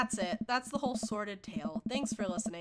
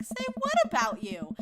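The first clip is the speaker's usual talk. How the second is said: much higher, louder, incredulous squeal; indignant disbelief; 7 dB louder than usual. Retold or imitated. [name] retelling her shocked reply to Noah, high and indignant. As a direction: throw the question back in shock. Acted quote, confronted by text.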